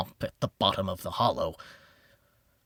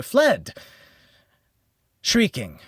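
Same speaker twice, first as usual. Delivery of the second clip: alarmed rush; 6 semitones higher, 6 dB louder, slower than usual. A narrator's reading. Revellers flee in terror.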